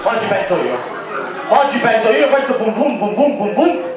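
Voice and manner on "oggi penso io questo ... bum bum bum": usual speaking voice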